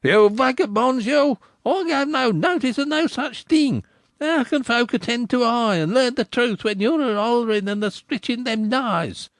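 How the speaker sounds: put-on crier shouting at boys. A narrator's reading. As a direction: bellow at the boys like an indignant town crier. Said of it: "and shouted".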